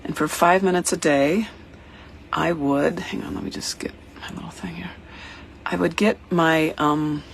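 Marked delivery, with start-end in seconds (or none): 3.0-4.9 quick, hushed off-mic whisper; whispered aside. Real, not acted. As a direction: mutter it to yourself while reaching for something. Aside while fetching something.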